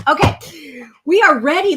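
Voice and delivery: loud, high announcement that it's starting; excited. Real, not acted. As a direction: kick off the show with energy. Starting the live card challenge.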